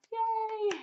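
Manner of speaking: a very high, bright little cheer; happy cheer at the lab news; cheery yay. Real, not acted. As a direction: squeal it with delight. Glad news about the lab.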